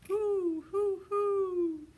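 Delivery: high, loud owl calls; loud owl hoot. A narrator's reading. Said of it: "He was shouting".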